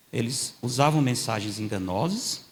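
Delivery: ordinary talk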